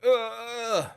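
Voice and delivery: loud, slow, high effortful groan; straining grunt. Pretend. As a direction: grunt like lifting a heavy bar. Mimes a weightlifting strain.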